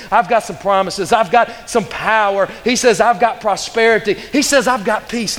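fervent, driving pulpit delivery; full-force preaching. Real, not acted. Preacher urging the congregation out of the wilderness, fervent preaching. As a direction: preach it hard, punching key words. Preacher voicing God's promises to Joshua.

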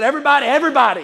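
quick, high call to the crowd; loud imitated rallying call. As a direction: retell a preacher rallying everybody, loud and fast. Quoting preacher calling out to crowd.

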